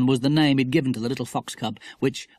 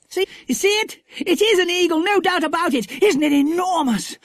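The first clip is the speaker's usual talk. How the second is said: very high, loud, breathless excitement; a boy pointing at eagles, overjoyed; thrilled boy; 5 dB louder than usual. A narrator's reading.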